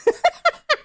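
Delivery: squealing laugh after the bit; real laugh cracking up at the joke; high burst of laughter. Real, not acted. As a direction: break into a high giggle. Laughs at own impression.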